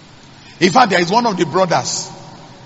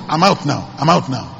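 ordinary talk, nothing marked

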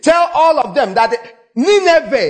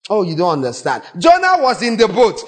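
normal talking voice

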